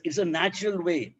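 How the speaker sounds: usual speaking voice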